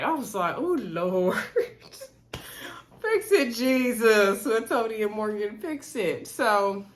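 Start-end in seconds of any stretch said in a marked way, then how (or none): 0.6-3.0 laughing. Real, not acted. Laughing disbelief at the couple. 3.0-6.3 mock plea. Real, not acted. Mock prayer about the couple.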